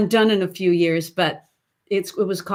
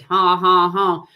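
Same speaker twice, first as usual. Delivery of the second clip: curved-lip honks; 3 dB louder, slower than usual. Shown as a demonstration. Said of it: "the lips are a little bit curved".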